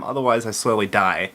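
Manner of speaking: ordinary delivery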